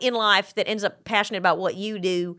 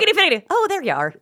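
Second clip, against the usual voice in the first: lightly put-on Irish, higher at first; Irish accent attempt; 6 semitones higher, faster than usual. Trying the actor's trick, mock Irish accent. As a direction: try out a playful Irish lilt. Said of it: "like, an Irish accent".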